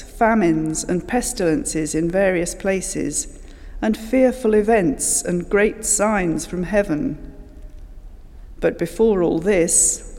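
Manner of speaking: ordinary talk, nothing marked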